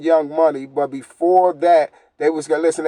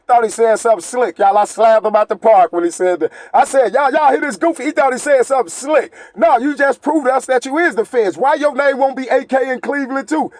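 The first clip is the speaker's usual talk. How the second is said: fired-up rant; 6 semitones higher than usual. Real, not acted. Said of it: "fired-up rant at rival".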